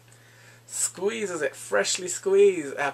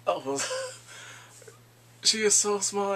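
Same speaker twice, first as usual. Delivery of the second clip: ordinary talk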